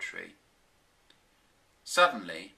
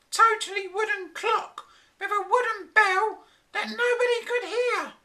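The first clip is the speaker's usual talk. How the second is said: high, loud scornful nagging; scornful wife; 6 dB louder than usual. A narrator's reading. Flora mocking the professor's inventions, high scornful voice. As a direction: pour scorn on him in a high voice. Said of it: "she scorned".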